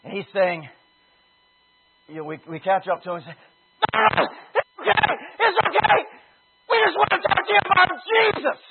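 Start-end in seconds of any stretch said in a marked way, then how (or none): 3.9-8.6 high, pleading, out-of-breath calming; breathless reassurance. Retold or imitated. Young evangelists catching up to the fleeing man, breathless reassuring. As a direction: talk him down after the run.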